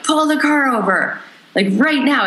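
frantic high demand as composure cracks; losing it. Retold or imitated. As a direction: break into desperate pleading, pitch shooting up. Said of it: "then I started losing it".

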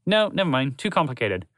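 plain reading voice

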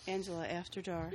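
creepy seductive voice. Pretend. Naming their podcast, a hint of creepy sexy voice. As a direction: purr the title like a seedy host.

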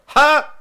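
loud, high, abrupt "ha!"; imitated theater reaction. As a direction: mimic your own loud burst of reaction at a movie. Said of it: "you just hear me go".

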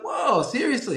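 high, amazed question; stunned, voice jumps up; retold amazement. Stranger among first ten owners.

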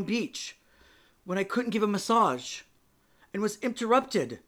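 loud, high, upset pleading; patient pouring out her heartbreak to the therapist; pained outburst. Acted.

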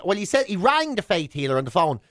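usual speaking voice

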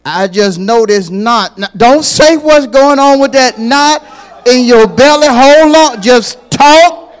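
loud, high, pleading preacher's cry; full-force preaching. Acted. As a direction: preach it hard, urging the church.

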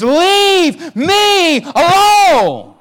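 high, slow, loud shout; cries out. Acted. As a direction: cry out in defiance at Jesus. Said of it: "he cries out and he says".

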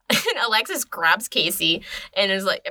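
ordinary delivery, nothing marked

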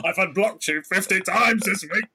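lightly voiced Connery; mock Sean Connery. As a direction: moan at the sink like Connery. Sean Connery at kitchen sink.

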